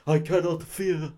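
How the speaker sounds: high, slow, singsong hammy voice; singsong Dracula. Retold or imitated. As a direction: sing the lines like an overacting vampire. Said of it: "I'm gonna do Dracula very singy".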